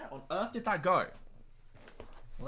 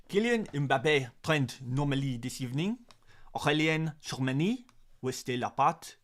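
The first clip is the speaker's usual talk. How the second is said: French accent. Shown as a demonstration. Streamer reading a translated French tweet to viewers, in a French accent. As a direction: read it out in a careful French accent. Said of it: "let's do it in a French accent as well".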